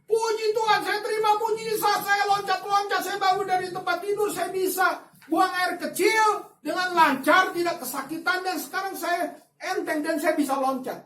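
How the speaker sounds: healed man's overjoyed report; joyful shout. Retold or imitated. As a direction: shout the miracle news with joy. Healed man phoning with joy.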